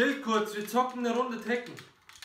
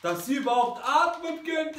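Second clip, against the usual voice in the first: shouting insult; 6 semitones higher than usual. Acted. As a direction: yell the insult at them. Insulting the other players.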